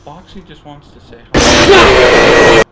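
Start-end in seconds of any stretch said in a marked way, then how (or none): 1.3-2.6 loud, slow teasing shout; loud mock shout. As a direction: bellow it to scare him. Friend yells to scare player.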